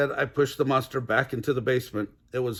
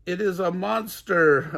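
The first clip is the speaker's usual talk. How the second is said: mild mock bawling; 6 semitones higher, 3 dB louder than usual. A narrator's reading. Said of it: "I bawled".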